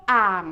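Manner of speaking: อ่าง held low in pitch; low, sinking pitch on อ่าง. Shown as a demonstration. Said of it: "is a low tone".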